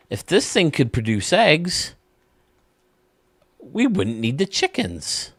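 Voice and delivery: high, slow goofy husband. Acted. Husband grabbing wife's boob, high slow goofy voice. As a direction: stretch it out high and dopey.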